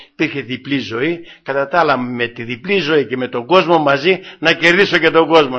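ordinary talk

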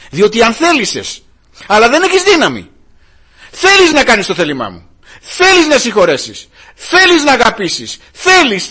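high, loud rebuke from the pulpit; fiery preaching. Acted. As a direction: hammer the congregation with every question. Preaches at full force. (φλογερό κήρυγμα, δυνατά και ψηλά)

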